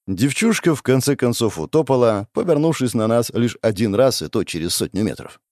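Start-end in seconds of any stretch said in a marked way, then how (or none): none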